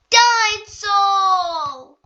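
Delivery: long, loud, drawn-out sung roar; dinosaur growl. Acted. George at the aquarium tank, long loud dinosaur roar. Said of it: "growls George".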